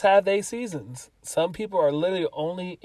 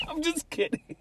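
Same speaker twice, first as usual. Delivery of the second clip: high, softer words through laughter; laughing; 11 semitones higher, 5 dB quieter than usual. Real, not acted. Friend backing off a murder joke, laughing. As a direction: laugh your way through taking it back.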